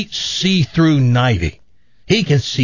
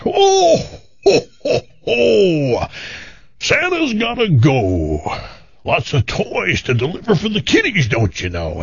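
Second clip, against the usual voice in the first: jolly Santa voice; 7 semitones higher than usual. Retold or imitated. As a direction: play a cheery Santa turning her down. Santa voice in told joke.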